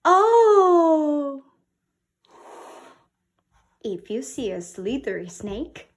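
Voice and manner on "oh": high, loud amazed "Oh!"; admiring oh. Shown as a demonstration. Teacher modelling amazement at a peacock, high and loud. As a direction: gasp in wonder at the peacock.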